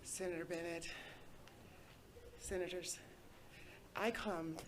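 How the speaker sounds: very soft and faint, off-mic; speaking too far from the microphone; barely audible. Real, not acted. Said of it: "Tanya, your voice is very low".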